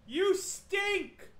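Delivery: high, loud jeer at the ghost; screamed taunt. Pretend. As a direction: yell the insult to provoke it. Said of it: "Open the door and scream".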